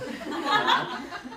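high silent wheeze of laughter; high breathy laugh. Real, not acted. Wife cracking up at her own advice, giggling.

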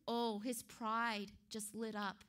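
normal talking voice

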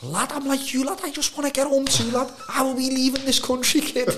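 hammed-up homesick lad at night; homesick lads moaning. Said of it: "be like".

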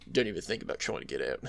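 low, fast, creepy Aussie voice; creepy little voice. Retold or imitated. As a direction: menace him quietly and fast. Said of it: "him having that, like, creepy little voice".

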